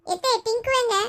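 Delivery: put-on high little-kid question; child's voice. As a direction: call out to your aunt like a child. Child figure asks for cousin. (உயர்ந்த குழந்தைக் குரல்)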